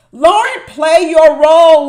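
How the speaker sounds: loud, high call: Lauren, play your role; exasperated yell at a reality show cast member; yelling at the screen. Real, not acted. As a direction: shout it at the TV like a frustrated fan.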